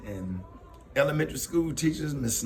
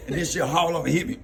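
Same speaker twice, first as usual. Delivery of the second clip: usual speaking voice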